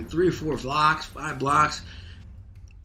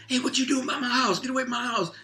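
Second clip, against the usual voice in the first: much higher shrill-lady mock yell; 13 semitones higher than usual. Neighbor lady yells at stranger by her spigot, high mock yell. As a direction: call out like an angry neighbor across the street. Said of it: "I hear some lady yell".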